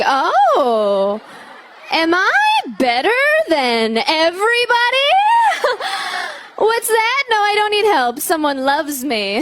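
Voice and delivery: giddy, laughing smugness; smug floating bliss. Retold or imitated. Engaged woman gloating in Target, laughing and smug. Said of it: "float through Target like".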